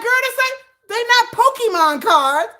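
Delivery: high, loud and slowed; high loud disbelieving protest; exasperated. Real, not acted. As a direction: spell it out in disbelief. Teller exasperated.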